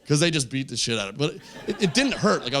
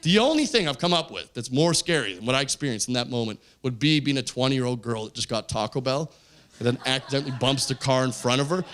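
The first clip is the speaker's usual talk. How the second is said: normal talking voice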